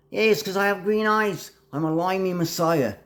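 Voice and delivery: high-pitched mock Australian voice; Australian accent. Claiming he's the messiah, in a mock Australian accent. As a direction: squeak out a jokey Aussie accent. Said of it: "sometimes I'm Australian".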